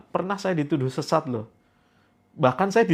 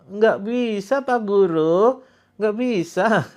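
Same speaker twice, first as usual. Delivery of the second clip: mock whining pupil; 6 semitones higher, 4 dB louder, slower than usual. Voices whining pupil to teacher.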